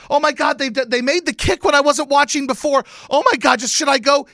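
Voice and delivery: high, loud flustered mimicry; frantic imitated quote. Fan quoting woman over a kick she missed, frantic.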